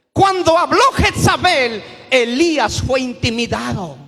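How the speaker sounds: thundering pulpit delivery; fiery preaching. Acted. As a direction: preach it out, building to a shout. Preacher builds to full force.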